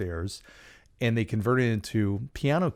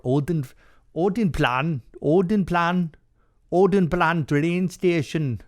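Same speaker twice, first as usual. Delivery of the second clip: Odenplan said in a higher, louder mock Swedish accent, slowing on the last one; 7 semitones higher, 5 dB louder, slower than usual. Shown as a demonstration. Host testing his Swedish accent on a station name, playful mock Swedish. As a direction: try out Odenplan like a Swede, hammed up. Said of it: "my Swedish accent".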